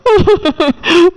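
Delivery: a high, wailing sob bursting out; sudden sob. Acted. Wife breaking down over her husband's bride-viewing, sob. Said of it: "எதுக்கு இப்படி அழுதுட்டு நிக்க?".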